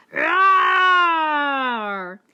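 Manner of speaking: loud, long, furious lion roar; raging roar. Acted. Trapped lion raging in the net, loud furious roar. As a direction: roar with rage. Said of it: "He roared with rage".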